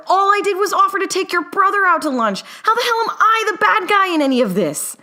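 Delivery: high, loud indignant yelling; outraged shouting. Acted. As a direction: yell in disbelief. Newt keeps shouting.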